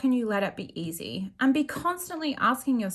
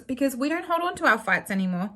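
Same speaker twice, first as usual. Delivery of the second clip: ordinary delivery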